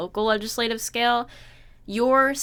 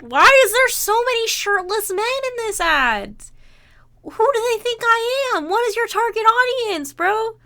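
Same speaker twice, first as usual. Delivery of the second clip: higher, louder disbelieving complaint; indignant high-pitched outburst; exasperated high rant; 10 semitones higher, 5 dB louder than usual. Real, not acted. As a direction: rant at the ads, fed up. Exasperated at shirtless ads.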